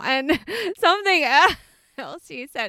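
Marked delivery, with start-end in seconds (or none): none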